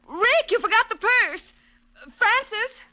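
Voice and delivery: high, loud call across the house. Acted. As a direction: call the butler from another room. Helen calling out to servant.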